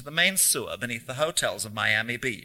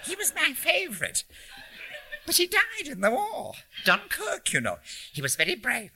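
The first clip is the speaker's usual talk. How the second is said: put-on high-pitched North Country old lady; storytelling in a North Country accent, leaping up high; 13 semitones higher than usual. A narrator's reading. Old lady telling a stranger about her sons, North Country accent. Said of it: "in an English North Country accent".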